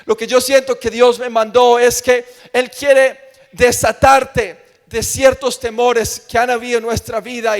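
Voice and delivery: ordinary talk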